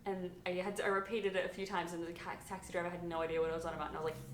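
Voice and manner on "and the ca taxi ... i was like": ordinary delivery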